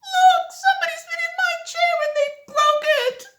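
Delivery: very high, fast, loud crying; crying baby bear. Acted. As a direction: wail like a little cub. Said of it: "Baby Bear said".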